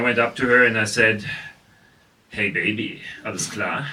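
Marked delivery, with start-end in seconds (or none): 2.3-3.9 put-on low, soft German-accented pickup line; heavy German accent. German trying to pick up a lady at a bar, German-accented come-on. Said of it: "if I really lay on the German accent".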